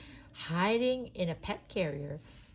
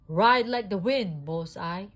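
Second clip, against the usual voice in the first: Woody urging Bullseye to gallop after the plane; rallying cry; 3 semitones higher, 9 dB louder than usual. A narrator's reading. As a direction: spur the horse on. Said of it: "Woody cried".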